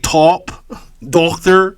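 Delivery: slow, exaggerated Erie accent on "top" and "Doctor". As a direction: stretch out a mocking Erie accent. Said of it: "You like".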